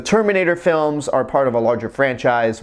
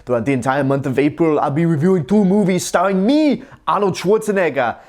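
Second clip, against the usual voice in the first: Arnold Schwarzenegger; 3 semitones higher than usual. Acted. Reviewer opens the channel's movie month posing as its star, Austrian-accented impression. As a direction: put on a hammy Austrian action-star accent. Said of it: "I am not going to do that impression".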